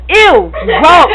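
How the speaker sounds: giggly loud ew; laughing disgust. Real, not acted. Girl grossed out by her own word, laughing. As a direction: recoil with a laugh.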